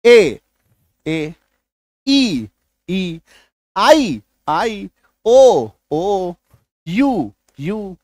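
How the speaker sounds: teacher's drawn-out "ए, ई, आई, ओ, यू", with pauses between; long vowel sounds. Shown as a demonstration. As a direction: draw out "ए, ई, आई, ओ, यू" as long vowels, each said twice. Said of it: "long vowel sound है".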